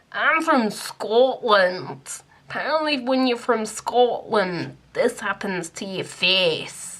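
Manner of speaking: slow, hammed-up Scottish accent; a badly faked Scottish burr, drawn out. Shown as a demonstration. Joking to viewers in a terrible Scottish accent. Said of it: "I'm just terrible at accents".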